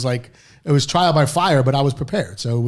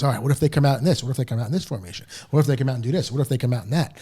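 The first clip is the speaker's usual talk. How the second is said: quick-fire questions, a touch fast; rattling off questions; faster than usual. Retold or imitated. Head coach grilling the new assistant, rapid questions. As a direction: fire the questions off one after another. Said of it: "he starts rattling off questions".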